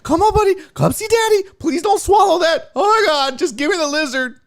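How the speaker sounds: high, loud begging to a pet; acted frantic pleading. Owner begging cat to give up lizard, high mock-frantic plea.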